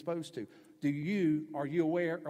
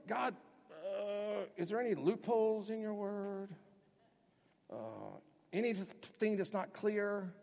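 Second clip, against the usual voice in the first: higher, slow, hesitant voice asking for loopholes; mock sheepish prayer; 6 semitones higher, slower than usual. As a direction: play a squirming sinner asking God.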